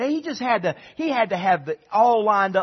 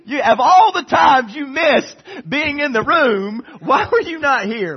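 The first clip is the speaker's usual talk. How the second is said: mock scolding; 7 semitones higher, 7 dB louder than usual. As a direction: tease him as if you were there. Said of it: "I was like".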